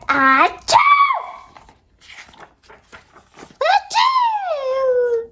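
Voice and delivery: big shouted sneeze, rising high. Acted.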